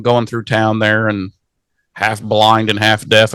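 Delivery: normal talking voice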